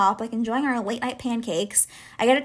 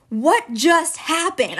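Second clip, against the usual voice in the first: slow, higher-pitched, stunned disbelief; retold shock; 4 semitones higher, much slower than usual. Teller recalling her date storming out, shaken and incredulous. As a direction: draw each word out in bewildered shock. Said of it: "I was, like, so shook".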